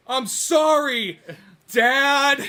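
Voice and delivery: loud, high, drawn-out cry; hammed-up son pleading with his father; mock screamed apology. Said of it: "this look that screams".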